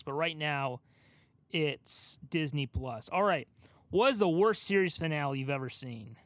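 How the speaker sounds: ordinary talk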